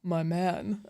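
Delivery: wooden delivery. Retold or imitated. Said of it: "he doesn't even say it like that".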